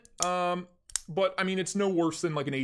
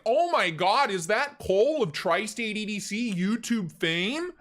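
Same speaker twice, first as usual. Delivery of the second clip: loud, high excited yell; imitating a friend's yell; 5 semitones higher, 4 dB louder, slower than usual. Friend greeting the streamer across a crowd, loud excited yell. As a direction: shout it across the room like spotting a celebrity. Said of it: "he'd just yell it at the top of his lungs".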